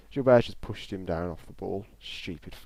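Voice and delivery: ordinary delivery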